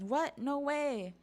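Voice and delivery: put-on high-pitched child in dismay; acted childish alarm, slowing into the plea; distressed kids. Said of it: "the giant kids are like".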